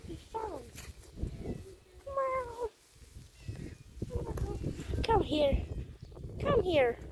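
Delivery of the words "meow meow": high imitated meow. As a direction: mew at the cat like a kitten. Meowing at the cat.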